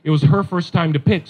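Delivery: ordinary talk, nothing marked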